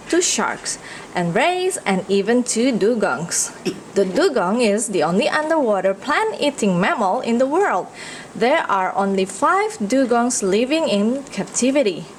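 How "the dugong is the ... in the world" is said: ordinary delivery, nothing marked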